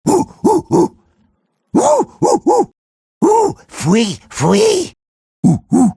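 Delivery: high hoots and whistly squeals; short, high repeated hooting; imitated animal calls. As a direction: hoot and squeal in quick bursts. Imitated chittering noises.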